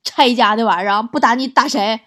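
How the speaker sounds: loud, scolding mother; glaring angry mum. Acted. Mum blames child for buying the destructive dog, angry scolding. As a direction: lay into your child loudly. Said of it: "老妈瞪着我说".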